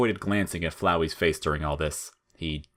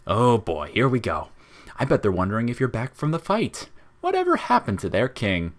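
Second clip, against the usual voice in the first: lightly raised, teasing little flower voice; Flowey voice; 5 semitones higher than usual. Acted. Flowey teasing Frisk about the monsters, lightly higher and sly. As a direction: needle him with a sly, higher-pitched flower voice.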